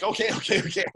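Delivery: high, hurried mock panic; acted high-pitched flustered driver; flustered compliance. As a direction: give in fast, hammed-up alarm. Acted driver reacting to urgency.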